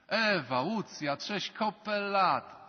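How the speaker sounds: hammed-up reunion squeal; mock delighted greeting. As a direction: gush like a long-lost schoolmate. Acts friends meeting after years.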